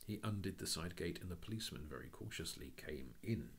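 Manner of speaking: plain reading voice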